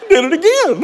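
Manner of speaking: high laugh breaking through the words. Real, not acted. Father laughing at daughter kicking his shoe again. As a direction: laugh out loud at the memory.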